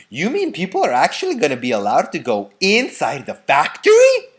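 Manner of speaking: put-on loud, eager old grandfather; excited cry. A narrator's reading. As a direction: cry it out in thrilled disbelief. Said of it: "cried Grandpa Joe".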